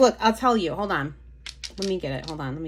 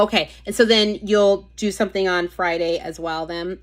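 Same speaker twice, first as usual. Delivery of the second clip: usual speaking voice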